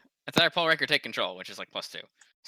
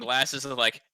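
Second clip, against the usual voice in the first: normal talking voice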